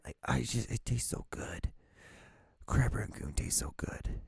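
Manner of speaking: breathy whisper of delight. Real, not acted. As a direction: whisper it like a treat. Savouring crab rangoon.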